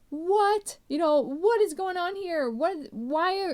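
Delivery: high, alarmed bewilderment; the sailors demanding answers, high and alarmed; panicked sailors. Retold or imitated. Sailors confronting Jonah during the storm, alarmed and high.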